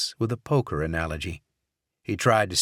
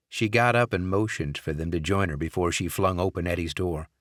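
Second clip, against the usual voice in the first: usual speaking voice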